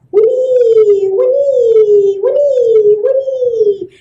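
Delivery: high loud sing-song whinny; high singing rain. A narrator's reading. Rain singing everywhere, high sing-song. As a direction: let the rain sing brightly.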